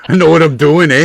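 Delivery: playful put-on Canadian accent with a laugh. Finishing the poet's joke, mock Canadian accent. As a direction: lean on the Canadian "eh". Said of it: "Poet said".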